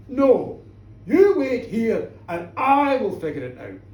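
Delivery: loud, firm order; booming, emphatic acted command; commanding refusal. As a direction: order the minister to stay put. Figure commands minister to stay.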